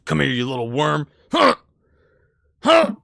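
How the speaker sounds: fast threat, then loud high grunts; straining menace. Acted. Severed head tries to grab its enemy, straining angrily. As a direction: strain to lunge at someone.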